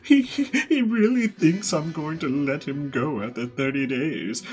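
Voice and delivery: high sneering voice ending in a laugh; gloating brat with cackle. Retold or imitated. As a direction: gloat over a betrayal. Helmeppo gloating to escorts.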